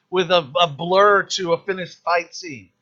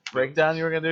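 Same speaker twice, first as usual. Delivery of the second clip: usual speaking voice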